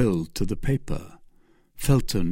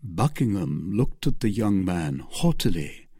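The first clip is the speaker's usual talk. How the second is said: plain reading voice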